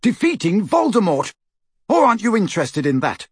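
high, forceful challenge; angry shouting. Acted. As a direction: throw it at her in anger. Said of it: "Harry shouted at her".

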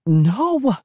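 high, urgent cry of refusal; sharp high outcry. Acted. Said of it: "I cried".

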